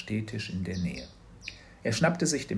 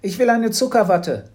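put-on much higher, louder whining little girl; girl whining to her mother for candy floss; whiny child; 11 semitones higher, 10 dB louder than usual. A narrator's reading. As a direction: whine for candy floss to distract. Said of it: "Ablenkungsmanöver".

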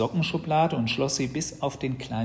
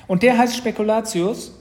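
put-on high, loud, curious child; pitched up and thrilled; excited little sister; 11 semitones higher, 9 dB louder than usual. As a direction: ask it in an eager little girl's voice. Excited sister quizzes brother.

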